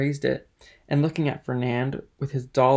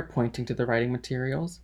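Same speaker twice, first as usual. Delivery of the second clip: usual speaking voice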